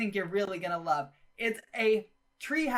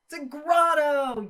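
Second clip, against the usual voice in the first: excited; 8 semitones higher, 8 dB louder than usual. Real, not acted. Excited reveal of built grotto.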